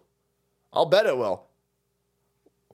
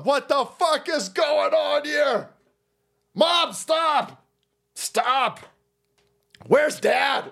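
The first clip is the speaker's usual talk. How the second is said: put-on much higher, pinched baby complaint; unborn baby voice; 9 semitones higher, much slower than usual. Unborn kid in the belly complaining to mom, high pinched baby voice. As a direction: whine like a baby stuck in the womb. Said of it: "that kid's inside that belly going".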